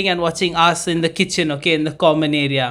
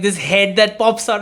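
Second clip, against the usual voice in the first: ordinary talk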